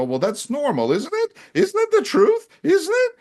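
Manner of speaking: mocking quote. Russian speaker insisting on racism, mock high voice. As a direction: parody their smug certainty. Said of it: "They go".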